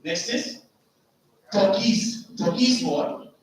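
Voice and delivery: usual speaking voice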